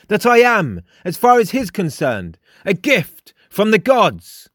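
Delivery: high, emphatic character voice; imperious, high and deliberate; commanding Frost. A narrator's reading. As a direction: give grand instructions, pitched well up. Frost grandly questions the raven.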